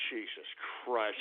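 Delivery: very low and soft; exasperated low groan of words; weary muttered curse. Real, not acted. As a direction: mutter it, worn down. Weary swearing after outburst.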